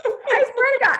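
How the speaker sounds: high, loud imitation of panic; squealed plea to an officer; mock frantic pleading. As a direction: swear it desperately, hamming it up.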